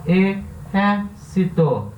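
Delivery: "Exército" slowly split as e, xér, ci, to; "Exército" stretched out, each syllable set apart. Shown as a demonstration. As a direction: sound out "Exército" one syllable at a time. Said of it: "É a terceira sílaba tônica".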